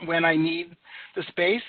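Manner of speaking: usual speaking voice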